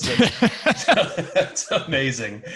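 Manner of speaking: real laugh breaking through words. Real, not acted. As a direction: laugh in amazement while talking. Host moved by the story.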